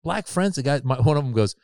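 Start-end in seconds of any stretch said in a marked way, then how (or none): 0.0-0.5 higher, softer challenging question; pointed question. Retold or imitated. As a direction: needle the conservative friends. Retold challenge to rich friends.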